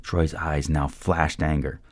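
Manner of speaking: ordinary delivery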